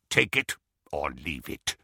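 low goblin ultimatum; final ultimatum. Acted. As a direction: drop low and close the deal. Goblin gives final terms.

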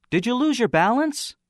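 very high-pitched questioner; voice from platform. Acted.

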